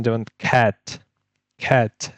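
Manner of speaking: খ্যাট with a hard k from the back of the tongue; teacher sounding out খ্যাট; velar k demo. Shown as a demonstration. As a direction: model খ্যাট crisply. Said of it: "ভেলার sound".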